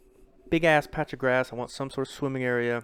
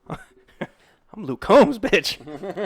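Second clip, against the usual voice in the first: loud, slow, higher laughing swagger; mock country star brag; 9 semitones higher, 9 dB louder, much slower than usual. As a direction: strut like a country singer, laughing. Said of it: "I'm like".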